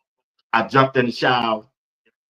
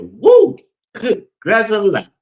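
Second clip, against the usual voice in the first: mock exclamation; 6 semitones higher than usual. Reacting to overly sweet tea.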